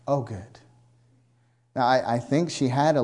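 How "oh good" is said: low, quiet, deadpan; mock flat reaction. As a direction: say it like nothing happened.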